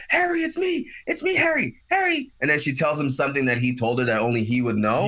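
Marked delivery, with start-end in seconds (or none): 0.1-2.3 high pleading voice; pleading girl voice. Retold or imitated. June begging Harry to recognize her, in a high pleading voice. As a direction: plead with him in a girl's pitch. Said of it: "and she's like".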